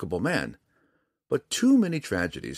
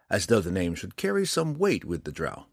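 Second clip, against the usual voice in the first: ordinary delivery, nothing marked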